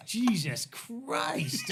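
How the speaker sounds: high, drawn-out exclamation; retold shock. Teen driver rattled after intersection, retold shock. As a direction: stretch each word in stressed disbelief.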